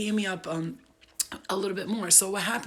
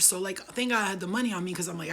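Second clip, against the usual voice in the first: ordinary talk, nothing marked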